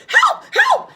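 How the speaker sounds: very high, loud panicked cry; screaming for help. Acted. Mrs Twit screaming for help in bed, high and loud. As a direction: shriek for help. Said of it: "screamed Missus Twit".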